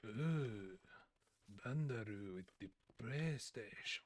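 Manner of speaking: put-on soft, drawn-out caricatured Japanese accent; hammed-up Japanese accent, hushed; mock Japanese accent. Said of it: "a mock Japanese accent".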